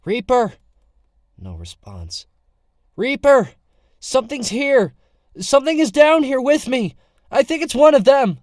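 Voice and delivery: desperate high loud yell; calling out. Acted. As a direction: shout for help at full volume. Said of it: "call out".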